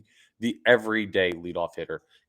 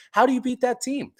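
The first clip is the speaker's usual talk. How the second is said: excited disbelief. Real, not acted. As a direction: rave once more. Repeats rave question.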